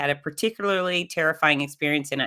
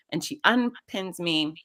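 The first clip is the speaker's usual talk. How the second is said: usual speaking voice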